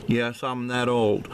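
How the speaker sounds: ordinary talk, nothing marked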